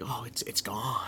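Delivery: whispered mock villain; soft, whispery put-on voice; Joker. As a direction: imitate a villain's hushed magic-trick patter. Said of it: "he does a little thing".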